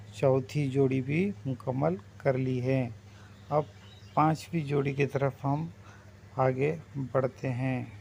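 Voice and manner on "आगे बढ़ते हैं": usual speaking voice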